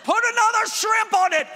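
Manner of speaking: very high, loud mock-Australian yell; Australian accent. Shown as a demonstration. Preacher repeating his dialect joke, exaggerated Australian accent shouted high. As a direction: belt it out as an over-the-top Aussie. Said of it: "The Australian".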